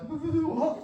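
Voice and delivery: high laughing what; stunned what. Retold or imitated. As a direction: squeak it in disbelief. Shocked by armless buyer.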